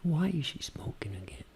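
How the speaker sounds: barely audible whisper; intimate voice. Shown as a demonstration. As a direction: whisper it privately to yourself. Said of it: "como se estivesse falando consigo mesmo". (sussurro bem baixinho, voz íntima)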